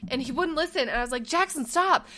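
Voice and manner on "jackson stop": raised warning. Retold or imitated. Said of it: "I'm, like, screaming in the store".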